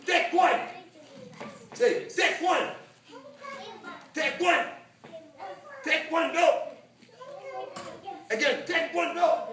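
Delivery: high, loud call to repeat; drill shout. Shown as a demonstration. Coach telling students to say it, high loud shout. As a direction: shout it out for the class to echo. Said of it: "I say".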